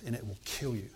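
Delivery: usual speaking voice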